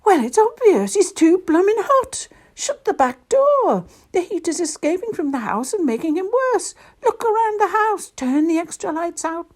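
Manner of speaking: high, loud elf rattling out orders; excited high-voiced urgency; urgent elf. A narrator's reading. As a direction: bark instructions to save someone, pitched very high. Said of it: "jumped up and said in a flash".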